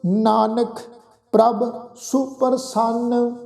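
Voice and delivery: drawn-out, measured chant of the verse; slow scripture recitation. Shown as a demonstration. Preacher reciting Gurbani to the congregation, slow and measured. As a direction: intone each word slowly and reverently.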